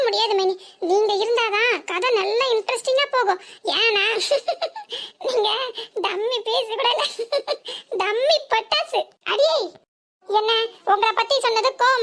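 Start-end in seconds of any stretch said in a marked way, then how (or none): 4.1-5.2 giggling mid-sentence at her own joke; short teasing giggle; burst of laughter. Real, not acted. 7.1-8.0 giggle. Real, not acted. Laughing at her own joke.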